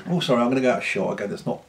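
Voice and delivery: ordinary talk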